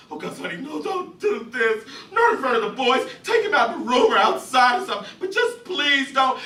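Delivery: high, tearful pleading; a mother desperately begging her husband in front of the boys; begging wife. Acted. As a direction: beg him to stop. Imogene begging.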